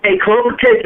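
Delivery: ordinary delivery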